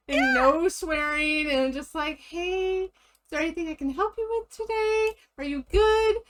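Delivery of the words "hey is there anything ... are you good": much higher, sweet and bright-toned; sweet, eager retail worker; customer service voice. Retold or imitated. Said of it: "my customer service voice".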